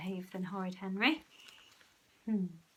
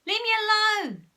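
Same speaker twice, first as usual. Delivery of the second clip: high, loud angry scream; 13 semitones higher, 12 dB louder than usual. A narrator's reading. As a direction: yell it at your brother. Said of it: "screamed Henry".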